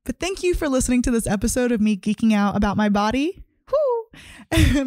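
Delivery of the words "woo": a high, joyful cheer; excited whoop. Real, not acted. Host cheers after thanking her listeners, excited. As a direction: let out a happy woo.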